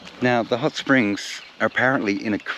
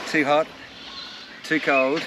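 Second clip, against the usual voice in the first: Goldilocks; 4 semitones higher, 6 dB quieter, slower than usual. Pretend. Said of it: "I feel like Goldilocks".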